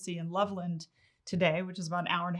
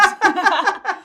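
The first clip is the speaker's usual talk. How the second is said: big high laugh after teasing her husband; a wife cracking up at her own joke about him; burst of laughter; 12 semitones higher, 13 dB louder than usual. Real, not acted. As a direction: laugh out loud, high and bright.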